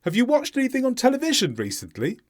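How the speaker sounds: quick, high question, "recently" going up; brisk model question for repeating, rising at "recently"; rising question ending. Shown as a demonstration. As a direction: stress "television", lift "recently". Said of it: "First the question".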